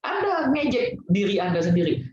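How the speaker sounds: normal talking voice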